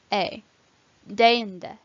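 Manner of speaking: "e" as an ay glide, then "deinde" with it; EI diphthong. Shown as a demonstration. Teacher modelling a diphthong, "deinde" with one ay sound. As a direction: say "deinde" opening with day. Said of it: "are pronounced as one sound".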